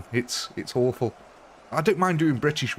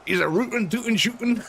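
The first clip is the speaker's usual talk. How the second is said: high cartoon Texas cowboy voice; Yosemite Sam; 10 semitones higher than usual. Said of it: "the only Texas accent I know is it Yosemite Sam".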